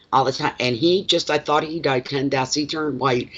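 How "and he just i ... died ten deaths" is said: ordinary talk, nothing marked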